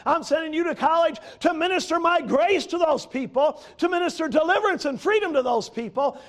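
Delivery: high, loud, rousing call; urging angel. Acted. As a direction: cheer her on like an angel calling. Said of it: "looking and saying".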